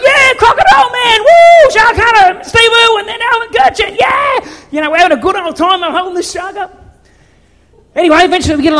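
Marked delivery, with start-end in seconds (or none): 0.0-4.5 much higher and louder rowdy whooping cheer; a crowd of young blokes hollering praise; mates cheering. Retold or imitated. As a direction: whoop it up like a pack of excited mates on a boat. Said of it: "all the boys are going".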